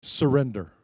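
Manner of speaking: loud, high, fervent preacher cry; pleading preacher altar call. Real, not acted. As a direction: preach the altar call at full force over music. Altar call at full force.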